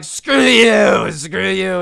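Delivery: high shouted comeback, then easing off; imitated angry yell. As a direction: hurl it back like a drunk Karen. Karen yells back at old man.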